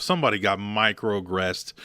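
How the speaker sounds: ordinary talk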